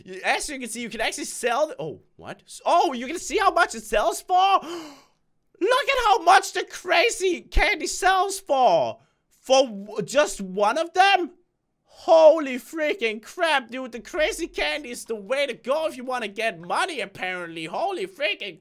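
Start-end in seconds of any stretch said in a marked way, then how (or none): 4.4-4.9 sharp gasp of surprise; surprised gasp. Real, not acted. Shocked at candy price. 5.6-13.9 higher, louder and slow amazement; amazed. Real, not acted. Amazed at candy price.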